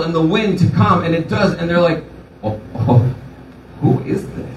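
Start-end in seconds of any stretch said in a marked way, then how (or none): 2.4-4.5 whispered gasp of amazement; hushed, stunned whisper; awed whisper. Retold or imitated. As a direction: breathe it out in wonder. Disciples awed by calmed storm.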